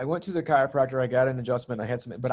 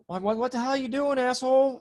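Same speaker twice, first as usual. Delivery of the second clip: high, exasperated mock yell at another driver; mock road rage; 10 semitones higher than usual. To a podcast host, retelling a driver incident, mock-angry yell. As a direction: snap at the driver, half-joking. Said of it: "one other time I kinda like".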